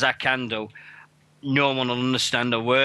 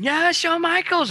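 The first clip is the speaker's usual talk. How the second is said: put-on much higher, slow girlish squeal; young niece cheering; 3 dB louder than usual. Ten-year-old niece cheering her hero Shawn Michaels, squealing. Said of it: "she's going".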